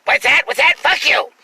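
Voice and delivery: hammed-up rude guy; rude jerk voice. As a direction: bark it like a belligerent stranger. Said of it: "In that voice, though".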